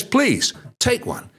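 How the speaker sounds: high, pleading offer; insistent seller. Acted.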